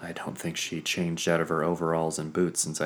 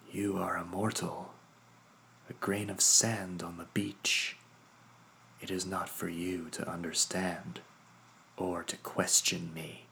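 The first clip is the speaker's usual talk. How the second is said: softer, cool amused condescension; amused Isolde; 5 dB quieter than usual. Acted. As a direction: talk down to him quietly. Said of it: "not so much with anger, but amusement".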